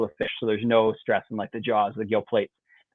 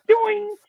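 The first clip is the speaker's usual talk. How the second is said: drawn-out high twanging "doing"; high springy boing. Retold or imitated. Angler imitating the third bite, long high boing. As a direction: ring it out high like a sprung line. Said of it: "I can only describe it with that word".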